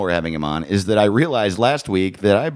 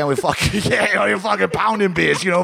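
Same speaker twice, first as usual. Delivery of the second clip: higher-pitched, racing New York accent; thick New York accent; 6 semitones higher, faster than usual. Shown as a demonstration. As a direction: rattle it off in slurred rural New York. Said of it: "it's a thick New York accent".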